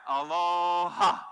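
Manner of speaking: long, loud aloha, drawn out slowly; slow, loud, ringing aloha; drawn-out call. Shown as a demonstration. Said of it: "So you try it".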